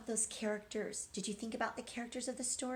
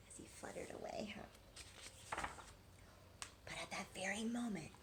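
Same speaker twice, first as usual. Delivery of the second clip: whisper; 9 dB quieter than usual. A narrator's reading. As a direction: whisper to build suspense. Storyteller builds suspense.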